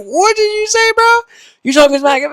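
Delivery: high, loud mock outrage; angry friend. Friend flipping out at you, high loud mock anger. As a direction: snap back like an offended buddy. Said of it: "they're like".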